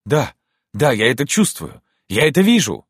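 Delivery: high, rising excited exclamations; excited cry. Acted. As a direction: cry it out, overcome with hope. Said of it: "вдруг крикнул он". (взволнованный, восторженный крик)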